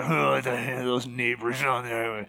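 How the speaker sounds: low, muttered griping; grumbling. Acted. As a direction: grumble under your breath about the culprits. Acted grumbling about the neighbors.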